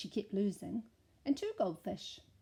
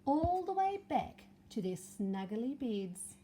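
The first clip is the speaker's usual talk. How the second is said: story narration; 2 semitones higher, 4 dB louder than usual. A narrator's reading. Story finale for children.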